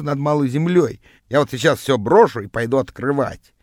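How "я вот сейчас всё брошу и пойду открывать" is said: plain reading voice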